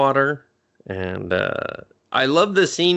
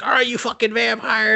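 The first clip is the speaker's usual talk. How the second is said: sneering Charlie; 10 semitones higher than usual. Retold or imitated.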